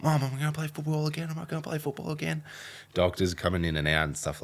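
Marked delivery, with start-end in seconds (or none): 0.0-2.4 put-on high, fast, pleading teenage voice; hammed-up tearful plea, much higher; teary pleading. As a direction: beg mum like a scared fourteen-year-old. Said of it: "I'm like".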